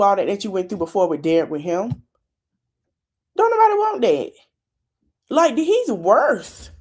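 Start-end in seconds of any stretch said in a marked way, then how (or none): 3.3-4.3 a much higher, emphatic sass; don't nobody want that, said high with attitude; sassy high exclamation. Real, not acted. Host scolding the guy's ex. 5.3-6.7 slow sassy aside. Real, not acted. Host judging the ex.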